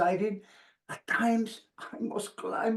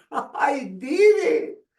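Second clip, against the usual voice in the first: triumphant cry; 5 semitones higher, 4 dB louder, much slower than usual. Pretend. Imagined reaching the top.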